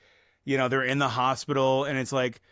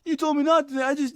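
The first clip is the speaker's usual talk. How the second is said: pitched way up, half-strangled; choked-up Rooster; 12 semitones higher than usual. Retold or imitated. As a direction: squeeze it out high like he can't finish. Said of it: "when he's like".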